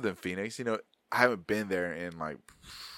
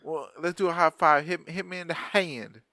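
mock twang; 6 semitones higher than usual. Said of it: "emphasizing on that stuff".

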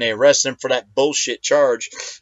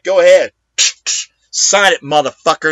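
defiant shout; 6 dB louder than usual. Pretend. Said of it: "I'll be like".

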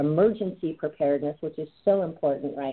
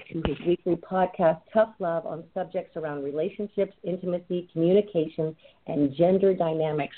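usual speaking voice

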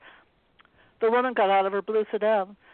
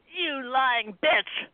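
slow, drawn-out snarl; 3 semitones higher, much slower than usual. A narrator's reading. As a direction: spit the insult slowly. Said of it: "snarled Joe".

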